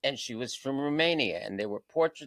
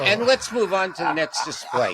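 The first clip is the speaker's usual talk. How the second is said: laughing through quote; 7 semitones higher, 8 dB louder than usual. Retold or imitated. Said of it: "And he said".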